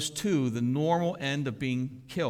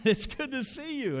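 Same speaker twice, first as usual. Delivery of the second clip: glad greeting; 12 semitones higher than usual. Acted. David greets Mephibosheth warmly.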